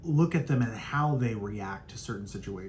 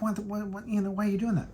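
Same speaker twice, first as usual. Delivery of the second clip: high, startled imitation of the boss; mock baffled boss; 8 semitones higher, faster than usual. Mimicking the boss catching her out, baffled high voice. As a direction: play the boss, stammering in disbelief.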